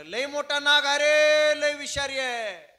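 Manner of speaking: high, drawn-out panicked yelling; loud cry for help. Acted. Man claiming a cobra bit him, crying out loudly. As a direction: holler it so the whole yard hears. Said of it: "त्याच्या भावाच्या कानावर हाक गेली".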